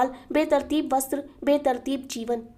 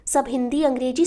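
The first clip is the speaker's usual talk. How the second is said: ordinary delivery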